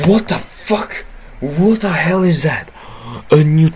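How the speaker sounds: high, loud stunned yelling; shocked outburst. Real, not acted. Player shouting in shock at the game. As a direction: blurt it out in total disbelief.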